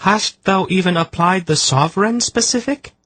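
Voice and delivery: high, ringing question with "specific" rising; an otherworldly seer pressing hopefully. A narrator's reading. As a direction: archaic query rising on "specific". Seer asks urgently.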